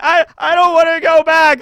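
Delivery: a hammed-up scared soldier; mock frightened refusal. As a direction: stammer out the refusal.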